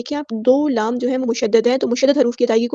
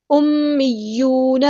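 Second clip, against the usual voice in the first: slow, drawn-out Quranic recitation; Tajweed recitation; much slower than usual. Shown as a demonstration. Teacher models the verse word, slow Tajweed recitation. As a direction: recite slowly, holding each long vowel. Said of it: "یا مشدد ہے تو اس کو جماؤ کے ساتھ ادا".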